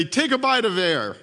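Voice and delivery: lightly voiced megaphone-throated dad. Big track coach coaxing his toddler in the pool, megaphone voice. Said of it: "he spoke with his megaphone voice".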